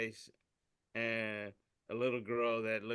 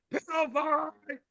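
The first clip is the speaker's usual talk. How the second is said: high, slow, hammed-up cave woman battle cry; mock battle cry; 7 dB louder, slower than usual. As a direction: stretch it out like a silly warrior. Cave woman shouts with log.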